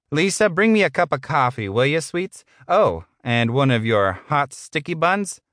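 high-pitched boss, sweet-talking his secretary; sleazy boss. Acted. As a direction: order coffee with a lecherous lilt.

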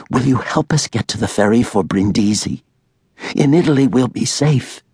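put-on frightened young woman asking for help; Allie pleading. A narrator's reading. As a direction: beg urgently for help.

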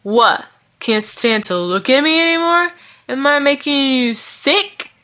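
put-on high, drawn-out drunken taunt; angry yell. A narrator's reading. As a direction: lash out, stretching the last question. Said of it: "yelled Vaughn".

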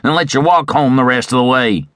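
high, drawling Western tough; rough cowboy bully, pitched high; jeering robber. A narrator's reading. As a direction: taunt him like a bullying outlaw. Robber jeering at his victim.

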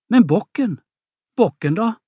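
worried mother asking; anxious surprise. Acted. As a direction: ask in sudden alarm. Mother anxious about the buck.

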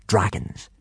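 plain reading voice

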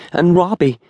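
high-pitched, desperate insistence; acted desperate denial. A narrator's reading. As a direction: plead it with the voice pitched right up. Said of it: "she insisted desperately".

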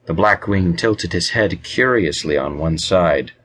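usual speaking voice

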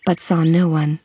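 ordinary delivery, nothing marked

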